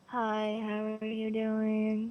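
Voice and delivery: slow, soft, bored imitation of a streamer; a lightly done lifeless hello; mock monotone greeting. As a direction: drone it out like a dull streamer. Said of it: "then I'll be like".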